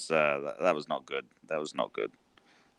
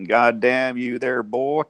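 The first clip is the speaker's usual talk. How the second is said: slow, higher Southern drawl, mock yokel; Southern twang; 6 semitones higher, 3 dB louder, slower than usual. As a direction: drawl it out like a Carolina farmer. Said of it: "You got a little bit of North Carolina twang in there".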